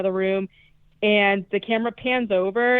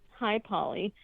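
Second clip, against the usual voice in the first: ordinary talk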